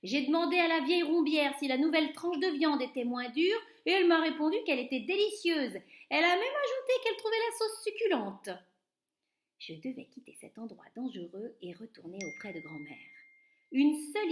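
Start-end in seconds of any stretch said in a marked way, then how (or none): 0.0-8.5 high, loud mocking storytelling; gleeful loud telling. A narrator's reading. Waiter gloating to the cooks, high loud mockery. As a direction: crow about it to the lads. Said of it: "s'exclama-t-il".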